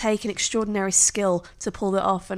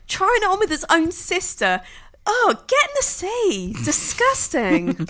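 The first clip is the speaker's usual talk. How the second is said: disgusted; 9 semitones higher than usual. Real, not acted. Disgust at Commodus.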